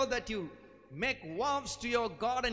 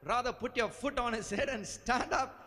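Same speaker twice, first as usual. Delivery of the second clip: ordinary talk, nothing marked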